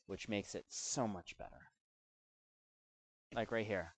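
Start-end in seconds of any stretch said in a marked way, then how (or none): none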